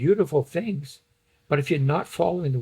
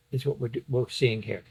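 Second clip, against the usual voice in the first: ordinary talk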